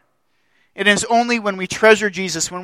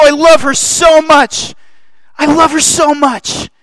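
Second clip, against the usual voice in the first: much higher, shouting, giddy with delight; God overjoyed; 10 semitones higher, 9 dB louder than usual. Acted. God marveling at his bride-to-be, shouting with joy. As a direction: gush like a thrilled groom. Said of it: "what God probably does".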